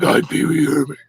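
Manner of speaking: lightly voiced creepy Pee Wee doll; a hint of a haunted doll; possessed doll voice. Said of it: "it sounds like it's an active vessel".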